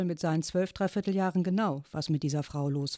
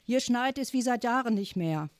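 shouting back; 7 semitones higher than usual. A narrator's reading. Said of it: "schrie Großmutter zurück".

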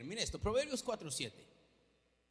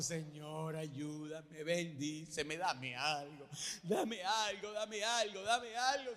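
soft, repetitive begging, rising; hammed-up pleading; mock whining prayer; 4 semitones lower, 5 dB quieter, much slower than usual. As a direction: whine it like a needy believer. Mocking a whining believer's prayer.

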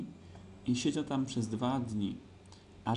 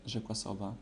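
usual speaking voice

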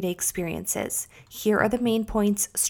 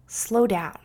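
ordinary talk, nothing marked

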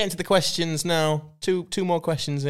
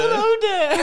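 much higher, louder laughing Yoda squeak; mock Yoda through laughter; 5 dB louder than usual. Co-host mocks the Yoda impression, squeaky and laughing. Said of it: "That's what Yoda says".

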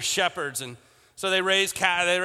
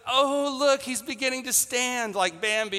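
higher, gushing family voice; mock excited gush; 6 semitones higher than usual. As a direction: coo over the new lamb. Family gushing over a newborn lamb.

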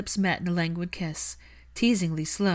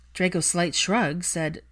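ordinary delivery, nothing marked

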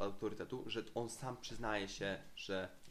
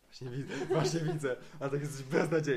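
teasing repeat, growing louder through laughter; laughing mock echo; 3 semitones higher, 7 dB louder than usual. Real, not acted. Co-host teases friend over sarcasm remark, laughing mock echo. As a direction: throw the words back, laughing.

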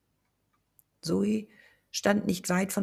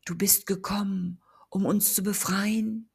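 ghost voice; 3 semitones higher than usual. Acted. Ghost addresses the narrator.

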